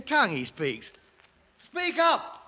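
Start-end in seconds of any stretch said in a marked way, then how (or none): 1.6-2.4 higher, raised demand across the distance; officer hailing a native chief; commanding call. Acted. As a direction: call out to a stranger. Questioning the foreign priest.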